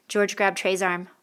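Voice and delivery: ordinary delivery, nothing marked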